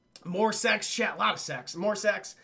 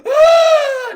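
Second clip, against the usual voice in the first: very loud, high sustained scream; imitated scream; 18 dB louder than usual. Arm wrestler with snapped arm, imitated agonized scream. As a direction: shriek it out, held long. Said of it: "Mikey's just screaming".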